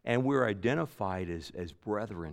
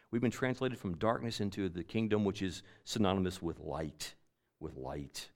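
much softer, lower, fading on with light; hushed repeat; 4 semitones lower, 7 dB quieter than usual. Real, not acted. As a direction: draw the congregation in quietly. Preacher drops to soft reflection.

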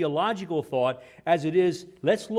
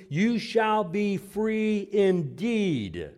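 drawn out slow, a touch higher; slow emphatic close; 4 semitones higher, much slower than usual. Acted. Preacher closing the verse, slow and emphatic. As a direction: let free indeed land slowly.

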